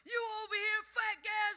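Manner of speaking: high, loud mock abuse; imitated hollering. Ironworker berating the new apprentice, imitated high holler. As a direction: call it out like a foreman shouting.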